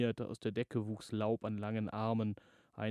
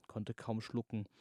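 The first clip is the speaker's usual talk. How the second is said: ordinary delivery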